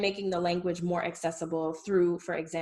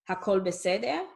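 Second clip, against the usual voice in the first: "Hakol beseder" asked, rising at the end; question intonation. Shown as a demonstration. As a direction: lift the end of "beseder" to ask. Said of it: "saying is a question".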